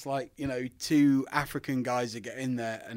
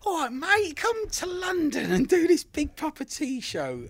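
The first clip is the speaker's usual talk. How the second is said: high, loud mock voice of the promoter; chirpy caller voice, lifted well above his own; imitating Phil Power; 5 dB louder than usual. Friend calling to invite him to a show, high mock voice.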